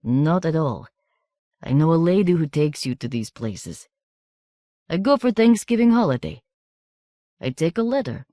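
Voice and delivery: put-on measured, knowing foreign boy; foreign boy, lightly voiced. A narrator's reading. Markasev telling Miranda he knows her family, lightly accented.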